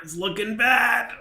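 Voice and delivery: high, loud, drawn-out sing-song; sing-song verdict. Pretend. Game master to player on the fish's damage, teasing sing-song.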